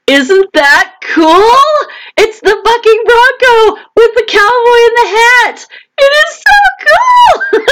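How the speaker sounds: very high, giddy excitement, building to a squeal; thrilled squeal. Real, not acted. Author shows viewers her new sticker, thrilled squeal. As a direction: gush in a high voice, stretching the key words.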